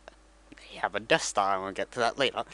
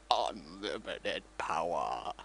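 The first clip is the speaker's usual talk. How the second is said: lower, soft and slow; sinister emperor line; 7 semitones lower, 6 dB quieter, much slower than usual. Retold or imitated. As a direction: savour it like a creepy old villain. Villain quote, that happens later.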